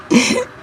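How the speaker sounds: a quick embarrassed giggle; high giggle. Real, not acted. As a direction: giggle at her own words. Laughs after her confession.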